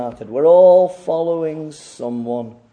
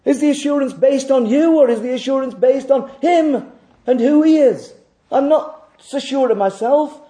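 high, driven pulpit delivery; emphatic preaching; 9 semitones higher, 5 dB louder than usual. Real, not acted. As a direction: preach it with raised pitch and force. Preaches at full force.

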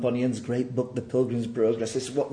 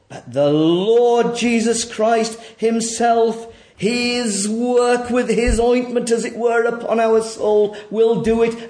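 raised, ringing sermon voice; forceful preaching; 9 semitones higher, 9 dB louder than usual. Acted. Preacher pressing the congregation to admit their sin, forceful. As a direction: press the hard truth on the congregation.

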